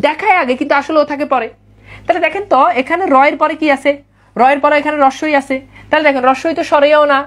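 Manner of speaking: ordinary delivery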